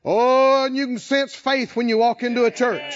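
ordinary talk, nothing marked

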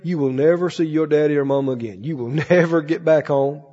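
low, quiet sneering taunt; a hint of a mocking devil; lightly voiced devil. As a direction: whisper doubt like the tempter.

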